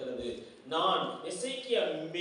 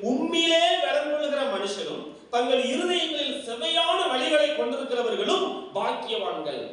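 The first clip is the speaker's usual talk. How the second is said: fervent pulpit reading of the verse; preaching a verse; 8 semitones higher than usual. Acted. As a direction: proclaim the scripture to the congregation. Preacher repeats verse forcefully.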